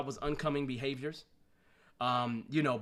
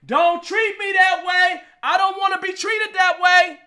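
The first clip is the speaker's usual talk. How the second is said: much higher, louder, slow hammed-up plea; hammed-up outcry; 13 dB louder than usual. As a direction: stretch it into an over-the-top wail of protest. Repeats the complaint, hammed up.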